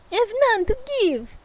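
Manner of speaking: high, fast Russian ghost; Russian accent. Acted. Ghost answers Scrooge in a high, fast Russian accent. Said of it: "That's Russian, idiot".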